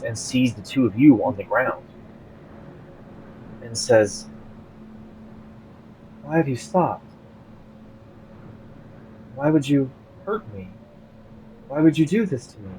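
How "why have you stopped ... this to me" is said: hurt siren. Acted. GM voices hurt sea creature.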